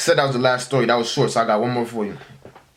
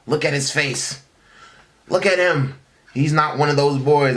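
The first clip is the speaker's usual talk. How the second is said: a mother's raised voice, higher, defending her son; mom turning up; 4 semitones higher than usual. Retold or imitated.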